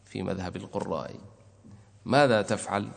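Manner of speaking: ordinary talk, nothing marked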